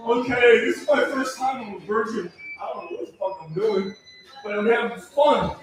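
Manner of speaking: normal talking voice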